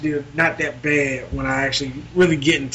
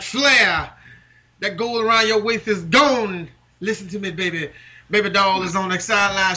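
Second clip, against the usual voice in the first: Dusty Rhodes impression; 5 semitones higher than usual. Doing Dusty Rhodes cutting a promo on Flair, preacher-style. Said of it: "I can actually do Dusty Rhodes".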